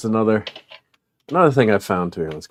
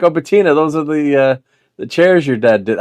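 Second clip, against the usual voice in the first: higher, louder, delighted re-enacted question; excited recognition; 6 semitones higher, 6 dB louder than usual. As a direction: blurt it out as if just spotting them. Re-enacts spotting the chairs in basement.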